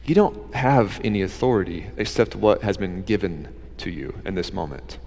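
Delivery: very soft, low, unhurried reply; calm and quiet. Retold or imitated. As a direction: answer calmly, barely above a murmur. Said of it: "I'm sure very calmly and quietly".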